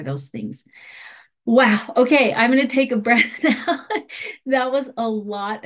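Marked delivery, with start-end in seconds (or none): 1.4-5.7 higher, louder, laughing exhale of relief; real laugh after a breathless rundown. Real, not acted. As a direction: burst out, laughing at your own rush. Relieved after a rushed presentation.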